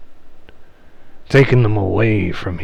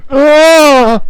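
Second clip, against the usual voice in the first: sharp ghostly cry of pain, much higher and louder; 10 dB louder than usual. Acted. Spirit bitten by the hound, crying out in pain. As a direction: yelp as the teeth sink in.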